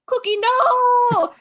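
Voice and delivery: high, slow wail of grief; anguished cry. Acted. Sun bear crying for Cookie, high anguished wail. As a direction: wail for the fallen friend. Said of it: "the other sun bear goes".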